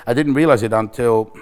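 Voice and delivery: ordinary talk, nothing marked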